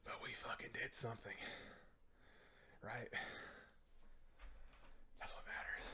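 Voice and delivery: whispery, voice gone after chanting; barely any voice left, hoarse whisper; numbed throat. Real, not acted. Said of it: "I can't feel my throat".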